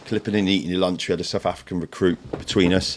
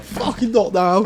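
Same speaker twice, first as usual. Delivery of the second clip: high, slow, strained plea; panicked plea; 5 dB louder, slower than usual. Retold or imitated. Terrified on the ledge to his teasing mate, high and slow. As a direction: stretch it out high and desperate. Frightened at the drop, answering mate.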